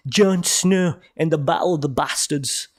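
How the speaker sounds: put-on Game of Thrones-style accent; put-on Jon Snow accent. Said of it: "I don't know why I did that accent".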